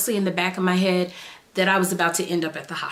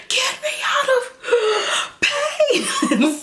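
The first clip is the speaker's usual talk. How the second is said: high, cracking plea with a gasp in the middle; begging through labour pain, half laughing; pained cry; much slower than usual. Retold or imitated. Pleading to the doctor in labour, pained cry.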